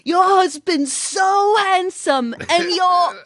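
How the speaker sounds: slow, high, loud mock gush; imitating gushing Jim. Wife mimicking Jim praising her husband, slow high gushing. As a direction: stretch it out high and loud, mimicking a smitten man. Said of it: "Jim came up to me, he's like".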